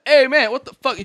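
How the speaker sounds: high, loud indignant gang member; mock yelling. Gang member challenging a homeless man shambling out, mock yell. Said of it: "They're yelling".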